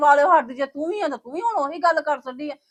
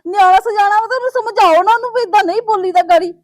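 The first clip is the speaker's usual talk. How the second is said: loud, raised, tearful scolding; angry outburst; 4 semitones higher, 10 dB louder than usual. Acted. Daughter yells at her mother about the son.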